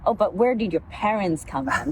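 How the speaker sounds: normal talking voice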